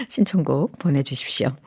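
ordinary talk

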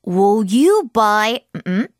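"Will you buy" slow, rising on "you"; drawn-out "Will you buy" rising into a question; question intonation. Shown as a demonstration. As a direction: model the question slowly with a lift at the end. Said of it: "will을 앞에 있는 주어랑 위치만 싹 바꿔주면".

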